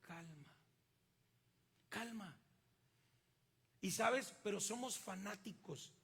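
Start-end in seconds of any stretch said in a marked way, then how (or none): 0.0-2.4 soft soothing "calma"; hushed calm. Shown as a demonstration. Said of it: "tienes que aprender a estar en".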